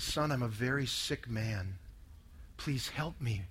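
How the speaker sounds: very low, hushed and slow; tearful confession, retold. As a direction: softly relive a father's broken plea for help. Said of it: "and he started to cry".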